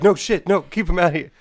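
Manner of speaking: hammed-up hurried refusal; retold panic. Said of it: "He's like".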